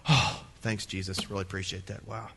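dry, unimpressed gratitude; mock grateful disciples. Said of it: "As if the disciples would go".

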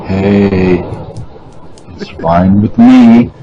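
very low, mock late-night host voice; low-pitched, laid-back parody of a host; smooth host parody. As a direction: drop way down into a put-on smooth radio voice. Jokingly acts out smooth host introduction.

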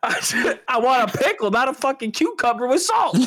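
high-pitched, exasperated rant; heated. Real, not acted. Ranting to friends about the diner pickle, heated. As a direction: insist indignantly, pitch well up. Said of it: "I'm steaming right now".

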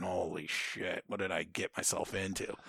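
soft, regretful self-talk; dismayed recruit. Retold or imitated. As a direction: drop the volume, as if to yourself. Retold recruit's quiet regret.

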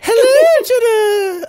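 very high, loud squeaky greeting; botched sultry voice. Pretend. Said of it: "I should do, like, a low, breathy voice".

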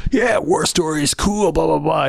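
a hint of hammed-up enthusiasm; mock guys' chit-chat. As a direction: lightly send up war-story banter. Voicing two characters idly chatting.

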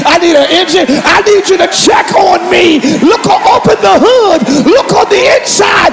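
fervent preacher in full flow; full-force preaching. Real, not acted. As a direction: drive each demand home, building to a cry.

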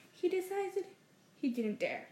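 plain reading voice

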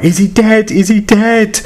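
very high, frantic repeated question; panicked screaming. Retold or imitated. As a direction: shriek it in retold panic. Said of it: "So I was screaming".

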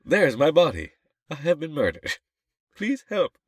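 high-pitched narrator in trouble; mock-distressed narrator. Narrator reporting own murder, high mock distress.

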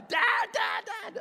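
high, loud mock scream; imitated child's screaming. Daughter screaming for her dad, comic high imitation. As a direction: shriek it like a demanding kid. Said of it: "she's usually screaming it".

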